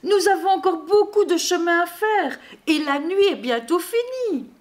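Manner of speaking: high, hurried storybook fretting; worried. A narrator's reading. Reindeer fretting that night is nearly over, worried. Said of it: "s'inquiètent les rennes".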